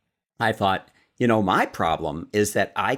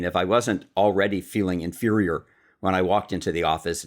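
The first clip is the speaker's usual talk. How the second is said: ordinary talk, nothing marked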